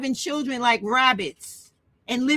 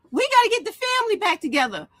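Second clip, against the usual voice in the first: higher, quicker mocking voice; mock pleading lines, pitched up and hurried; mimicking men's talk; 8 semitones higher, faster than usual. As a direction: parrot their slogans in a raised pitch. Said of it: "they talk about".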